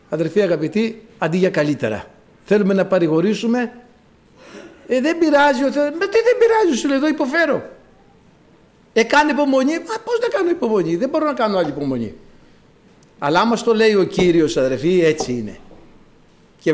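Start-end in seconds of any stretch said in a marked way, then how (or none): 4.9-5.9 high-pitched brushing-off comfort; acted glib consolation. Acts a clumsy consoler. 5.9-7.6 very high, indignant outburst; acted exasperated protest. Acts sufferer's exasperated reply. 8.9-9.7 higher-pitched platitude; acted glib advice. Acts a clumsy consoler. 9.7-12.1 starts very high, falls quickly to the end; acted exasperated plea. Acts sufferer's exasperated reply.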